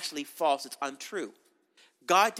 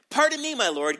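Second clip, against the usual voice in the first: raised, louder protesting "Pardon me"; complaining; 6 semitones higher, 6 dB louder than usual. Acted. Gideon objecting to the angel, raised and complaining. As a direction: push back with a raised voice. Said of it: "He's whining".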